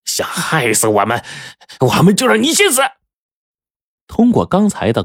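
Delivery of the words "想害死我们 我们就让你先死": strained high yell, rising to a peak; clamorous threat. A narrator's reading. Young robber with a knife at the driver's neck, clamouring. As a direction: build into a frantic threatening cry. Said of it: "李二狗叫嚣着".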